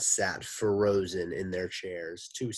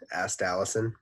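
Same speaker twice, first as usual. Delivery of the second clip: ordinary delivery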